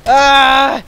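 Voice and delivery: loud high panicked yell; panicked scream. Real, not acted. Panicked fleeing from enemy.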